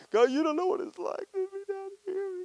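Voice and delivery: high, whining plea to God; whiny complaint to God. Retold or imitated. Believer whining to God about life down here, in a high complaining plea. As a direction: whine it up to heaven like a sulky child. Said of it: "we go to God".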